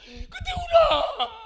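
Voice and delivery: high, loud, slow wail; wailing shout. Real, not acted. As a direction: cry it out high and long. Preacher wails about Hannah crying.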